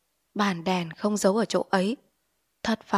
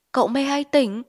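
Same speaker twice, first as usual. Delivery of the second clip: higher, louder scared question; frightened; 6 semitones higher, 4 dB louder than usual. Acted. Frightened wife asking her husband if he's awake. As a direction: ask in fear. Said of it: "Chị San sợ hãi".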